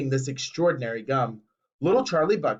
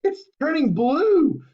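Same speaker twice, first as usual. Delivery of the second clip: high slow alarmed scream; 10 semitones higher, 4 dB louder, slower than usual. A narrator's reading. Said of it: "screamed Missus Beauregard".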